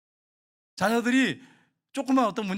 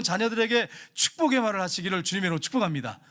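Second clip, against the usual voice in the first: normal talking voice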